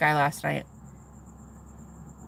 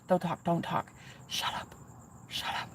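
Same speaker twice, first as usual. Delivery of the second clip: dropping to a near whisper, hushing him; soft, breathless shut up; hushed plea; 4 semitones lower, 15 dB quieter than usual. Retold or imitated. To the guy on the phone, hushing him, nearly whispered.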